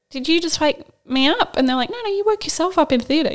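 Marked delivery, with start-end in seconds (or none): 0.1-1.6 higher, puzzled question; confused surprise. Retold or imitated. Patient waking in theatre, asking staff in confused surprise. 1.9-3.3 higher, breezy reassurance; reassuring staff. Retold or imitated. Nurse telling the waking patient she woke herself, lightly voiced.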